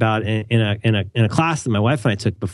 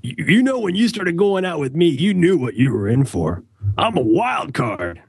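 higher, defensive dude, slowing down at the end; mock the guy brushing off his girlfriend; smug boyfriend voice; 5 semitones higher than usual. Speaker mocking his past self as a wild card, a defensive dude voice. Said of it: "in that voice".

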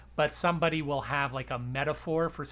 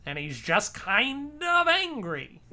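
Woodrow Wilson voice; 4 semitones higher, 5 dB louder than usual. Retold or imitated. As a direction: let it rise and stretch, mock-genteel. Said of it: "my Woodrow Wilson voice".

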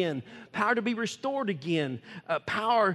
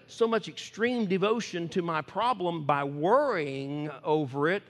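ordinary delivery

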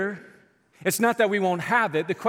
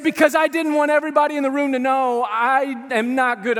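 high, loud, unbroken rush of explanation; hammed-up loud confession; impassioned retelling; 9 semitones higher, 8 dB louder than usual. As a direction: belt out the excuse for comic effect. Preacher heatedly retells woman's excuse.

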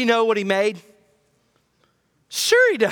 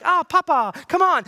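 casual, high, breezy whine; flippant mock prayer; 9 semitones higher, 4 dB louder than usual. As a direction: toss it off like a buddy. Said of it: "They weren't like".

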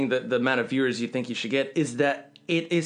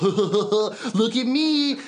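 slow, mocking high voice; 6 semitones higher, much slower than usual. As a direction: parody the game's humor.

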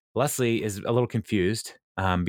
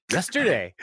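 mock Chris reply; 6 semitones higher than usual. Chris answering Donna's question.

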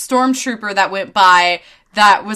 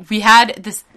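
usual speaking voice

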